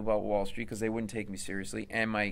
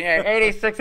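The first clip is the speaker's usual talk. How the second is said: cop on walkie-talkie; 10 semitones higher, 8 dB louder than usual. Acted. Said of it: "they're walkie-talkie".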